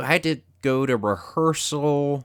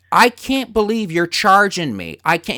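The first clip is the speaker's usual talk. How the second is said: higher, louder indignant rant; furious at the parking attendant, higher and louder; angry outburst; 5 semitones higher, 3 dB louder than usual. Retold or imitated. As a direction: vent fury at the charge. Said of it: "I was so angry".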